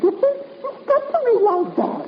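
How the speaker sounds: high, crying voice; frightened, near tears. Acted. Miss Dowdy pleading with Valentine, near tears. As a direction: voice breaking as she pleads.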